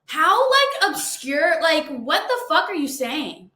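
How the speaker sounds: high-pitched, incredulous outburst; real exasperated disbelief. Real, not acted. Incredulous reaction to a hater's comment.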